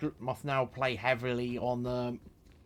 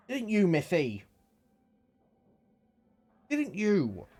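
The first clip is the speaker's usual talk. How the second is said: higher, cooing at the cat; talking to a pet; 6 semitones higher, slower than usual. Real, not acted. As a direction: coo at your cat.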